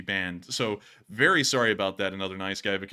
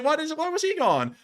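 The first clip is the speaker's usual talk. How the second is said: surprised. Retold or imitated. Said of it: "I was like".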